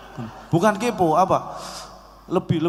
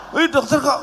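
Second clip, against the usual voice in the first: mock astonishment, pitched much higher; kaget; 9 semitones higher than usual. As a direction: repeat the doctor's question in high-pitched disbelief. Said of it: "yang bikin saya kaget". (kaget dan heran, nada tinggi)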